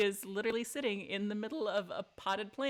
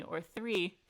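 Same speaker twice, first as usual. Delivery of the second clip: normal talking voice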